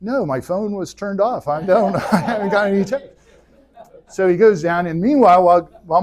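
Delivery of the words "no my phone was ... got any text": higher, sheepish excuse with a laugh; lightly voiced Moses shrugging it off; Moses making excuses. Moses answers God, sheepish excuse with a laugh. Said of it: "Moses is like".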